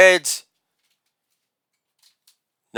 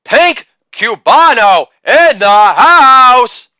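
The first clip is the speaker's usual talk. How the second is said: slow, loud and higher announcer belt; 6 semitones higher, 5 dB louder, slower than usual. Acted. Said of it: "belt out".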